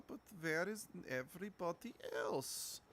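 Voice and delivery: usual speaking voice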